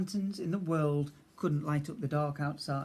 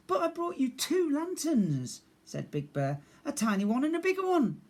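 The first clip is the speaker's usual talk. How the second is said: exasperated bear; 11 semitones higher, 4 dB louder than usual. Acted. Big Bear losing patience.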